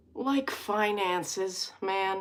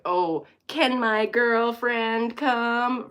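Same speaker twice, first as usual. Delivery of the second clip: slow, higher, lightly put-on Mulaney; mock Mulaney voice; 4 semitones higher, 4 dB louder, much slower than usual. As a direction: imitate a boyish ask. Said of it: "that John Mulaney thing".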